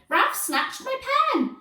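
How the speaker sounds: very high tattling schoolboy cry; shouted. A narrator's reading. Pupil tells on classmate to teacher, shouting high. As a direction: shout a shrieky complaint to the teacher. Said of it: "shouted Inky Ian".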